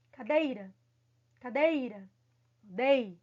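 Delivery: "Cadeira" pitched low with DEI as the peak, then DEI alone; stressed middle syllable. Shown as a demonstration. Teacher answering where the strong syllable is, stressed. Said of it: "a sílaba forte dela é aonde?".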